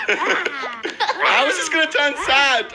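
high, drawn-out laughing question. Real, not acted. Laughing at the kids in the video.

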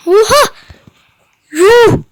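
loud, high startled outcry; shocked. Real, not acted. Gamer yelping at slime hitting him, startled. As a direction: blurt out real shock at the screen.

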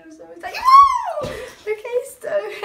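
giggly and bubbly with a woo; bouncing off the walls; hyper excitement. Acted. New cheerleader gushes to the camera, hyper. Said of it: "I just am really hyper".

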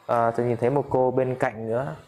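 usual speaking voice

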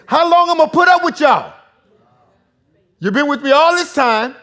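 loud, high-pitched exasperated scolding; frustrated master chewing out his helpers; hard rebuke. Acted. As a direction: lay into them, fed up and shouting. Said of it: "he's being hard right here".

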